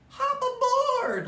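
very high, loud, slow bug cheer; laughing bug. Acted. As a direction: crow it out like a gleeful little bug. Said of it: "laughed the bug".

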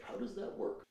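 put-on whispered, childlike puzzlement; hushed wonder. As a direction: whisper it like a kid in awe. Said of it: "I remember being a kid and this kind of, like, baffling me".

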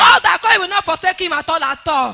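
loud, forceful church prayer; fervent prayer. Real, not acted. Testifier blessing the daddy G O before church, fervently. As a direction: pray it out with full force. Prays aloud for the G O.

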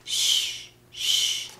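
drawn-out "shh"; hushing. Acted. Grandma hushes for the sleeping kitten, shushing. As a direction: hush them, twice.

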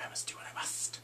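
whispered mock-solemn answer; hushed acted reply. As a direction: play it grave, all in a whisper.

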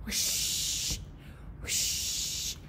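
soft breathy water whoosh; airy hose sound; whispered whoosh. Pretend. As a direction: blow it out like a hose.

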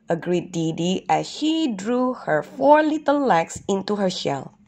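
plain reading voice

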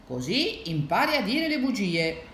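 mildly severe lesson-giving; stern. A narrator's reading. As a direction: tell a child off for lying. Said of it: "dicevano con aria severa".

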